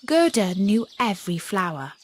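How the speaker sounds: plain reading voice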